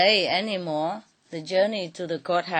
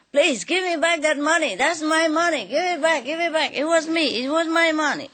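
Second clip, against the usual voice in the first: high, loud desperate begging; pleading shout; 9 semitones higher, 4 dB louder, faster than usual. Acted. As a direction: cry out for the money back, high and loud.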